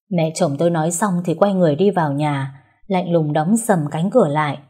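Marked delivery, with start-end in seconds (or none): none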